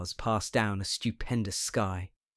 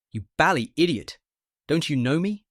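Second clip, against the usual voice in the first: furious; 6 semitones higher, 6 dB louder than usual. A narrator's reading. Patient furious at friend.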